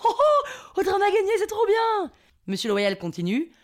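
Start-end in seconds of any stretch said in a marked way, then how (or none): 0.1-2.1 very high, laughing celebration; squealing excitement. Retold or imitated. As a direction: burst with joy at winning. She realises they have won. (explosion de joie suraiguë)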